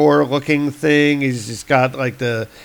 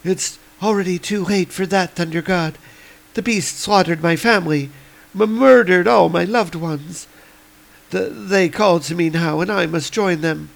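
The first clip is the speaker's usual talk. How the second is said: higher, lightly voiced dying plea; dying woman; 5 semitones higher than usual. Dying woman begging Thor to avenge her, higher. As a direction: plead with fading breath. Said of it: "the woman is like".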